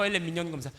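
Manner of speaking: usual speaking voice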